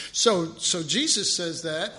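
normal talking voice

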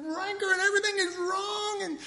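high, whiny quote of a disgusted visitor; complaining church guy. Retold or imitated. Retelling a visitor's complaint on Bourbon Street, high and whiny. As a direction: act out a fed-up man griping about sin. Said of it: "He said".

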